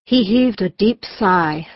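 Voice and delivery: usual speaking voice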